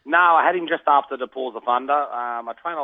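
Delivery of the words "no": usual speaking voice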